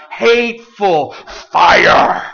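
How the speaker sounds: slow, loud, drawn-out shout; fiery shout. Real, not acted. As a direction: hurl the words out slowly and loudly. Preacher describes a hateful letter.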